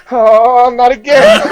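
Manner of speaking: loud, high comic wail; mock dread. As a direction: cry out in hammed-up despair, breaking into laughter. Retells dread as it recurs.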